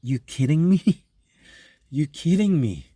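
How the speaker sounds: mock disbelief, rising at the end; incredulous. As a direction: play it as a half-laughing you kidding me. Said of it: "It's like today we're saying".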